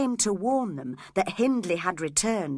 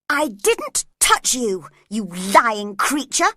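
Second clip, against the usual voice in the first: slow, louder, furious denial; angry cry; 3 semitones higher, 8 dB louder, slower than usual. A narrator's reading. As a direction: spit out the accusation. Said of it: "she cried angrily".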